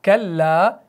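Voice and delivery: very slow chant with long held nasals; Quran recitation. Shown as a demonstration. Teacher shows how the famous verse is read, slow chant. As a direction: recite slowly, stretching each long vowel. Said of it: "क्या पढ़ते हैं आप".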